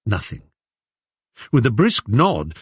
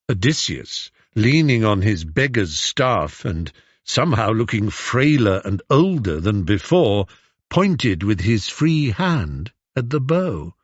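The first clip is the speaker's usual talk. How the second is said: ordinary delivery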